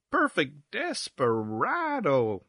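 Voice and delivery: very slow, swooping drawl; languid, drawn-out pronouncement; drawled syllables. Acted. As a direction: stretch each syllable to its limit. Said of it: "drawling out his syllables".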